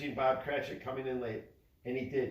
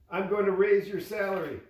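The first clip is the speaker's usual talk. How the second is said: much higher and louder, beaming; joyful announcement; 7 semitones higher, 8 dB louder than usual. A narrator's reading. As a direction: Scrooge surprises his clerk with a raise, joyful. Scrooge's surprise announcement.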